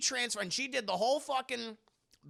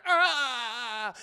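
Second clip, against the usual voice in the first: long, loud mock groan of revulsion; disgusted cry; 4 semitones higher, 6 dB louder than usual. Senator sees Mystique transform, disgusted mock cry. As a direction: cry out as if revolted. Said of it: "He's all disgusted".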